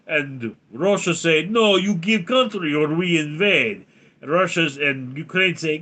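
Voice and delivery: hammed-up comic Russian; Russian accent. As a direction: tell it in a thick mock-Russian accent. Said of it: "talk like Russian".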